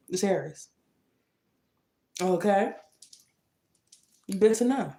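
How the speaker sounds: ordinary delivery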